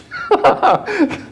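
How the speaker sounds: sudden high burst of laughter; real amused laugh; high giggle. Real, not acted. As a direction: laugh at your own slip. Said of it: "I'm channeling Bugs Bunny".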